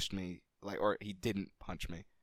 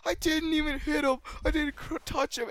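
high, loud, whiny pleading; crying protest; 4 dB louder than usual. Retold or imitated. Punished kid doing push-ups, whiny tearful protest. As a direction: protest your innocence through tears. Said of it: "And he was crying".

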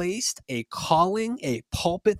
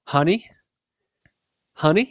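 higher, louder Southern "honey", lifted at the end; Southern accent; 4 semitones higher, 5 dB louder than usual. Retold or imitated. Older Southern hairdresser to her young customer, Southern accent. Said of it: "'cause that Southern accent".

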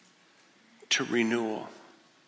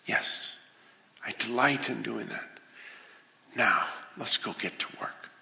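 lower, soft, delighted reassurance; gentle Father; 4 semitones lower, 5 dB quieter than usual. Acted. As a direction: welcome them back kindly.

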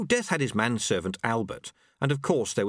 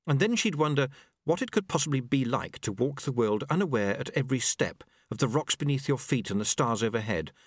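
ordinary delivery, nothing marked

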